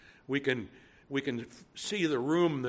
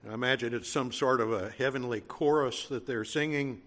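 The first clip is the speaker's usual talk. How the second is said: ordinary talk, nothing marked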